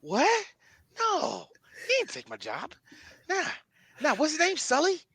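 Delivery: high, soft actor impression; Mark Wahlberg. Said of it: "He talks just like this".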